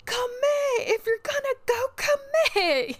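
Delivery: high, loud mock scream at other drivers; imitated yelling. Imitating friend yelling at drivers in the car, shrieky mock yell. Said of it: "yell and be like".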